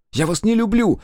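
high, loud desperate scream, stress on не. A narrator's reading. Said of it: "Я закричала".

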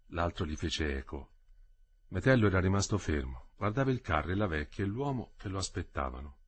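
usual speaking voice

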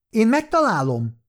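high-pitched, eager retort; indignant outburst. A narrator's reading. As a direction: burst in with an acted protest. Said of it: "csattant fel Likuva". (magas hangon felcsattanva)

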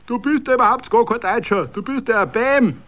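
high, loud jeering; shouted mockery from the crowd; mocking taunt. A narrator's reading. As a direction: rub it in with scorn. Heckler taunts the fake Hitler.

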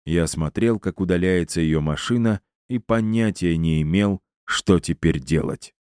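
ordinary delivery

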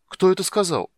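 high, loud cry of alarm; startled shout. A narrator's reading. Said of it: "крикнул он".

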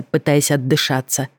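plain reading voice

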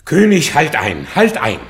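lauter Ruf, König zum Einhalten aufgefordert. Acted. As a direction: ruft laut und dringlich dazwischen. Belarius ruft König Einhalt zu. (Belarius ruft dem König laut zu, er solle einhalten)

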